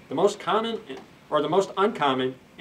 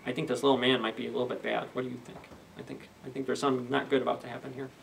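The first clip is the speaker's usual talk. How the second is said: hushed aside; 3 semitones lower, 7 dB quieter, faster than usual. Real, not acted. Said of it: "aside to child listeners".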